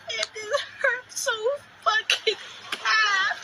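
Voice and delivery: real high-pitched crying, words stretched into a wail; sobbing hurt, voice way up high. Real, not acted. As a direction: wail it out while fighting back tears. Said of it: "I'm trying not to cry".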